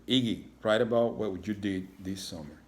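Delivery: usual speaking voice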